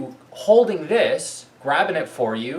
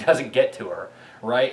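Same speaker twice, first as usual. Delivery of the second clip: ordinary talk, nothing marked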